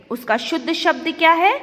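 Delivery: ordinary delivery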